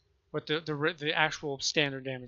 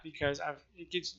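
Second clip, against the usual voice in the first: normal talking voice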